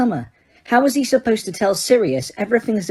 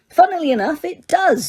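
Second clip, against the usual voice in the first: bored; 10 semitones higher than usual. A narrator's reading. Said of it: "in a bored voice".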